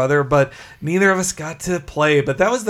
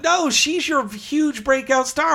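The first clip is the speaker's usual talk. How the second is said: high exasperated quote; 8 semitones higher than usual. Retold or imitated. Mocking audience reaction, high.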